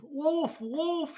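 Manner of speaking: very high, loud alarm cry; cat crying wolf. Acted. Jack tries to scare the birds off, very high loud alarm. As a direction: call out the fake warning. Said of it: "he cried".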